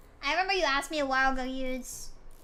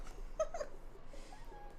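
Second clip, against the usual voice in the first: laughs; 13 dB quieter than usual. Real, not acted. Laughing at own rant.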